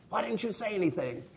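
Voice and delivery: a husband blaming his wife after the crash. Retold or imitated. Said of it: "I looked at my wife and I said".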